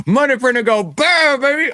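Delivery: high-pitched money printer cry; Jerome Powell. Acted. Predicting what the Fed chair will say, high hammed-up impression. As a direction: crow it high, rolling out the burr. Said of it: "He's gonna say".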